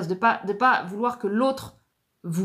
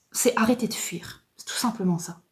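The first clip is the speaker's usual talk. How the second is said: whisper. Shown as a demonstration. Urging listeners to stop fleeing.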